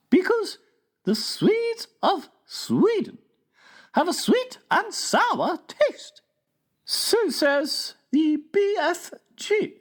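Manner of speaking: very high, slow, prim royal voice; regal Queen. A narrator's reading. Queen tells the King about giants, high and stately.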